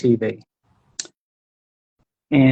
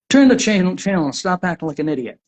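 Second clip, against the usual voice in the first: angry father; 5 semitones higher, faster than usual. Acted. Abusive father yelling at his little son over the TV, angry. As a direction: bark at the kid, then hurry on.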